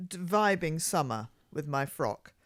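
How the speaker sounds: ordinary talk, nothing marked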